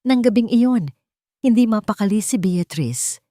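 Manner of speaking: ordinary delivery, nothing marked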